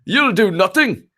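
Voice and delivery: high Irish shout of the line; Conor McGregor impression. Viewer's request, a Conor McGregor Irish taunt. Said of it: "doing an impersonation of ... Conor McGregor".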